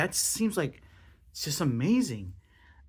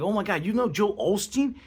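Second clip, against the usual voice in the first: starstruck excitement; 6 semitones higher than usual. Retold or imitated. Telling the room about a friend's famous contacts, excited gush. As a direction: gush like you just spotted a celebrity. Retells own starstruck reaction.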